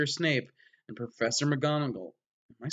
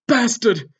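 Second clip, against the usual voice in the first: loud, higher insult; angry shout; 9 semitones higher, 9 dB louder than usual. Acted. Ebony curses the boy who spilled blood, shouting. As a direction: snap it out furious. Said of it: "I shouted angrily".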